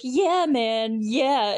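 loud stoner-buddy cheer; Jim Breuer. Retold or imitated. Movie fan mimics Stu cheering Billy on, loud hyped whoop. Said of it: "almost giving me, like, Jim Breuer vibes".

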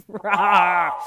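loud distorted roar through a voice changer; monster roar. Shown as a demonstration. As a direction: let out a beastly roar. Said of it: "This is Monster Voice".

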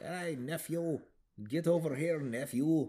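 high, loud cartoon duck-uncle call; Scrooge McDuck voice. Uncle calling his nephews over, in a high loud cartoon voice. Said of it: "And he's like".

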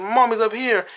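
a hint of a scared little girl. Little girl answering Bruce.

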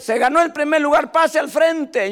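imitating the teacher calling the winner up; teacher announcing. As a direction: call the winner up to the front. Teacher announces first place.

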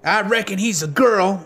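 put-on high-pitched kid's taunt; piping up. A narrator's reading. Gang kid joins in mocking Link, piping up. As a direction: chime in as a jeering young boy. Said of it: "another piped up".

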